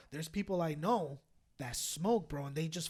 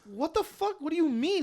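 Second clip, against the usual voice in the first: confused; 11 semitones higher, 6 dB louder than usual. Retold or imitated.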